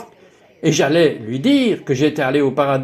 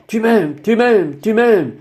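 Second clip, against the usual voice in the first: higher, delighted retold exclamation; excited exclaiming; 6 semitones higher, slower than usual. Speaker retells jumping up and down before God, excited exclaiming. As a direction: exclaim it repeatedly, pitched up with excitement.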